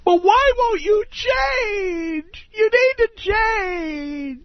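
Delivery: high, drawn-out wailing whine; mock shrieky lament, words stretched out; whiny nagging wife. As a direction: wail it like a pleading, fed-up wife. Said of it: "and then she's gonna say".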